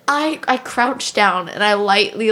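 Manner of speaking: ordinary talk, nothing marked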